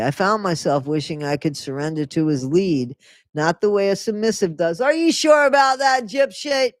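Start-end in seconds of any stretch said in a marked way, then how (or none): 4.8-6.7 loud, high-pitched incredulous heckle; mocking challenge. Real, not acted. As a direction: call her out with a scoffing shout. Heckles the memoir she reads aloud.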